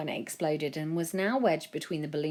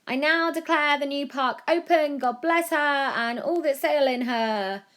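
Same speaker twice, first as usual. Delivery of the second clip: higher, louder proud mum declaring; 10 semitones higher, 6 dB louder than usual. A narrator's reading. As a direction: announce it grandly like a proud mum. Mum opens the park.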